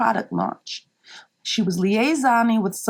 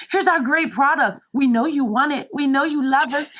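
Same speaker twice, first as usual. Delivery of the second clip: mock sales pitch; 6 semitones higher than usual. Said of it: "So here was this".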